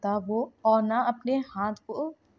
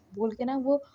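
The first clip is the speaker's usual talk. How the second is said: plain reading voice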